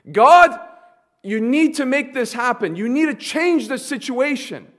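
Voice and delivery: God cried out loud and high, then pressing on; loud, urgent begging prayer; desperate plea. Acted. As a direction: cry out to God in panic. Jacob's desperate prayer acted out.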